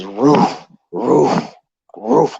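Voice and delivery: big dog woofing, done by a storyteller; imitated dog bark. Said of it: "dog barking".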